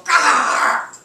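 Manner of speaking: loud throaty mock dog growl; imitated growl. Showing what a guarding dog sounds like with a mock growl. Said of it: "growl at me".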